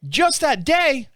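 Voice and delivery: high, slow disbelieving echo; incredulous. Retold or imitated. Caller echoing the rep's answer back, incredulous. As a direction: repeat it slowly in disbelief.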